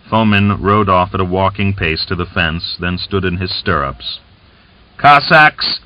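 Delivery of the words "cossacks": louder, higher call to the crowd; crying out. A narrator's reading. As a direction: call out over the dispersing crowd. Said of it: "he cried".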